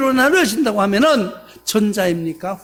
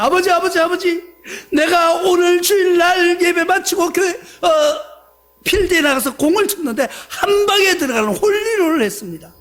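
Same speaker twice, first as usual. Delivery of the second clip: higher, louder overjoyed calling out; excited shout; 6 semitones higher, 4 dB louder than usual. Retold or imitated. Son tells pastor father about hole-in-one, shouting excitedly. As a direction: burst in bragging to your father. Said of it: "아버지에게 말합니다".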